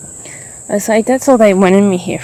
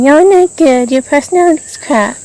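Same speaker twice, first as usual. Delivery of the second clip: mocking insult; 7 semitones higher, 5 dB louder than usual. Mimicking housemates' insults.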